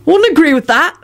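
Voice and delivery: high, anxious denial; desperate blurt. A narrator's reading. Ron placating intimidating Kingsley, desperate. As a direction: hurry to placate him. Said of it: "Ron blurted out desperately".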